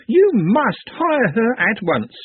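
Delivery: high, slow, insistent squeal; excited squeak. A narrator's reading. Pepe urging Madame to hire her, squeaking. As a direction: squeak it high, stretching the words. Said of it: "squeaked while clapping his or her cheeks".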